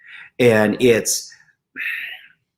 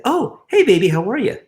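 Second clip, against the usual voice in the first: high, sing-song hello to a partner; acted-out doting husband on the phone; affectionate phone greeting; 10 semitones higher, 3 dB louder than usual. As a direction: greet your sweetheart in a lifted, cooing voice. Husband answering wife's call, retold.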